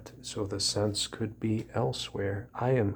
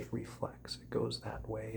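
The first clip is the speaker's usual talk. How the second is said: whisper; 9 dB quieter than usual. Real, not acted. Tracing reflections.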